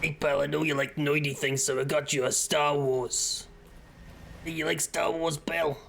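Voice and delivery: chummy put-on New Yorker; New Yorker accent. Gift giver handing pal a Star Wars, mock New Yorker accent. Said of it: "the New Yorker accent in my head".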